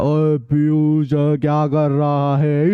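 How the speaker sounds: low and very slow, muffled and dragged; underwater muffled voice. Shown as a demonstration. Teacher demonstrating a call heard inside a swimming pool, muffled. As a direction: drawl it out as if heard through water. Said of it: "जब अंदर घुस जाओगे तो कैसे सुनाई देगा".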